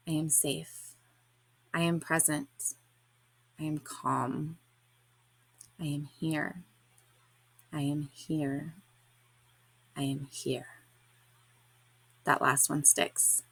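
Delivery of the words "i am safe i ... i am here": soft, slow, hushed self-soothing; whispered affirmation. Retold or imitated. Repeating the healer's affirmation during Reiki, hushed and slow. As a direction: murmur each line to calm yourself. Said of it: "I repeat her affirmation in a whisper".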